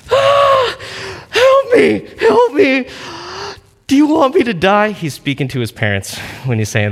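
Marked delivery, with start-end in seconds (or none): 0.1-4.9 put-on high, loud panicked child's wail; mock panicked little boy, much higher and louder; whiny kid crying for help. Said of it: "he was sitting there going".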